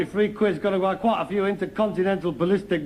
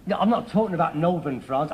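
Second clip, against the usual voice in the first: normal talking voice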